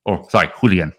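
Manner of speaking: ordinary talk, nothing marked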